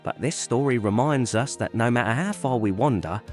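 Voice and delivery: ordinary delivery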